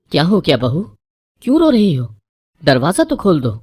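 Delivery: high, loud, anxious calling; mother-in-law calling out to the crying bride; calling through door. A narrator's reading. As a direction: shout through the closed door, worried.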